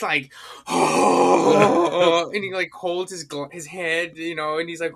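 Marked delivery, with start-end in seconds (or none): 0.7-2.3 loud exasperated mock groan; stressed-out groan. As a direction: groan loudly like a man at his limit. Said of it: "exhausted man in every scene".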